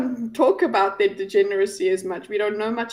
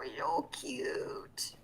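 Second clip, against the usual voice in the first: whispered, very slow and soft; 4 semitones higher, 15 dB quieter, much slower than usual. Real, not acted. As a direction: breathe it out to the cat. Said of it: "I can't even speak normally".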